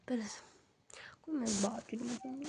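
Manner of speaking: whisper. Real, not acted. Husband still asleep nearby.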